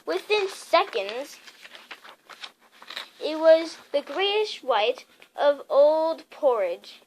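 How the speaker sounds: ordinary delivery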